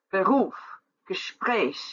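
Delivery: Beruf and Gespräch with the final beat stressed; stress on last syllable. Shown as a demonstration. Teacher models last-syllable stress in Beruf and Gespräch. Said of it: "the last syllable is accentuated".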